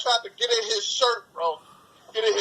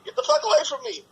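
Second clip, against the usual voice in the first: high frantic yell at the bee; panicked yell; 13 semitones higher, faster than usual. Retold or imitated. Scared kid yelling at a bee to get away.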